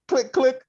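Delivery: gun click sound. Retold or imitated. Mimicking gun cock.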